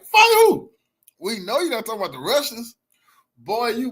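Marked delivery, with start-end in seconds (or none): 0.1-0.7 high, loud scoffing yell; incredulous shout. Real, not acted. Streamer yelling at senator on screen, high incredulous shout. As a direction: shout it like the idea is absurd.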